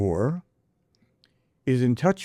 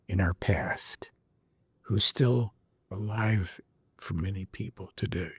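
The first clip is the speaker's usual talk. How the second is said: dramatic whisper; 4 semitones lower, 6 dB quieter than usual. Real, not acted. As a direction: whisper it like a secret. Dramatic hush on a question.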